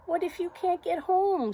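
high, loud anxious question; little doubting voice. Acted. Little voice warning Zoe about travelling, high and doubting. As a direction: fret aloud in a high little voice. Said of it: "says the little voice".

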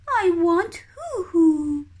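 high, whimpering chick wail; whiny crying chick. Acted. Baby kiwi begging mum for hoo hoo, high whimpering whine. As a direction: whine it like a hungry baby about to cry. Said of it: "says Hooey".

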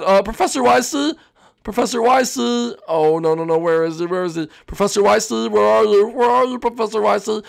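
searching for the teacher, worried; worried kid calling. Acted.

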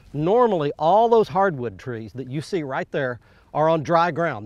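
ordinary delivery

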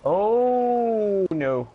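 high, loud startled oh; surprised cry. Real, not acted. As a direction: yelp it out in surprise. Teammate's plan going wrong.

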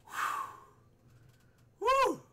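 long breath out, then a short high woo; real post-scare exhale; blown-out relieved whew. Real, not acted. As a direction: blow the fright out. Catching breath after the scare.

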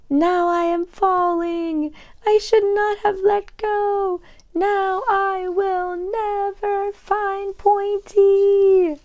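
high slow despairing wail. Acted. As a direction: wail as you fall. Tiny falling.

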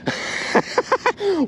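high giggling laugh; real high-pitched laughter; amused laugh. Real, not acted. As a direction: burst into a high, delighted chuckle. Laughs at what came up.

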